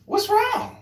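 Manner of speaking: concerned passerby. Retold or imitated. Himself asking the fallen man.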